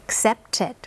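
accepted with the ed said as a clear extra syllable "id". Shown as a demonstration. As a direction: stress the added syllable in accepted. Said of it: "พอใส่ ed เข้าไปก็จะเป็นเพิ่มอีกหนึ่งพยางค์". (ออกเสียง accepted เพิ่มพยางค์ "อิด" ชัดเจน)

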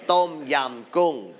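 "Tom yum goong" said slowly with a Thai accent. Shown as a demonstration. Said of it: "you need to say in Thai accent".

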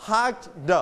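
"hugged" as hug, then a released "duh"; separated final d. Shown as a demonstration. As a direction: sound out the d at the end of "hugged". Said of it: "we pronounce it like".

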